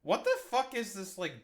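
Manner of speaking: ordinary talk, nothing marked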